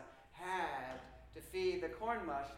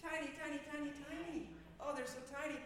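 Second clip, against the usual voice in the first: tiny voice; 7 semitones higher, 4 dB quieter, faster than usual. Acted. Stresses how small seeds are.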